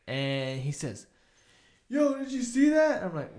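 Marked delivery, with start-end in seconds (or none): 1.9-3.0 high, loud stoned amazement; mock astonishment. Stoned friend seeing things asks his buddy, mock astonished. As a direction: imitate a tripping friend's wide-eyed outburst.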